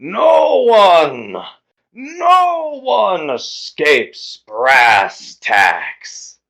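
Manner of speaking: loud, high, slow drawn-out bellow; trumpeting, stretched-out pronouncement; booming brass creature. Acted. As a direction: blare it out like a horn with a mouth. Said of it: "his large instrument of a mouth, he expels".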